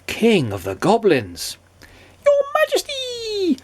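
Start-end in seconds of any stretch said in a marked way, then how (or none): 2.2-3.6 very high ceremonial goblin; goblin announcing. A narrator's reading. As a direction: proclaim in a tiny goblin pipe. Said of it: "announced the goblin".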